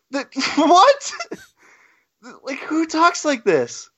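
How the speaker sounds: high, laughing disbelief; can't believe anyone wrote this; incredulous. Real, not acted. Mocking the comic's dialogue to co-hosts, laughing incredulity.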